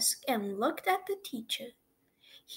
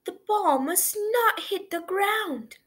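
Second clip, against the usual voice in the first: calling out; 9 semitones higher, 4 dB louder than usual. A narrator's reading. Team cries out.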